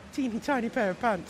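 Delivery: put-on squeaky high voice on teeny tiny. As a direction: pitch it up high and small. Mocking a tiny race suit.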